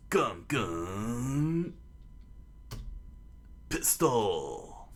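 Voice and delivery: drawn-out battle cry with swooping pitch; attack call. A narrator's reading. Luffy calling his attack on the serpent, drawn-out battle cry. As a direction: stretch each word of the special move.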